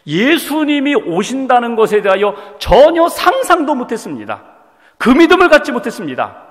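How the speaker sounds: ordinary talk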